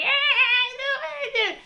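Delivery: very high, loud wailing; hammed-up child tantrum; mock tantrum wail. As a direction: howl like a toddler mid-tantrum. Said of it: "the kid throwing".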